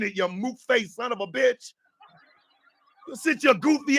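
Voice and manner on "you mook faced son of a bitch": usual speaking voice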